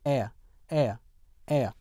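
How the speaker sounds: British air as "eh-uh", no R; British non-rhotic. Shown as a demonstration. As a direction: glide air into a schwa, British style. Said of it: "영국 표준 영어에서는요".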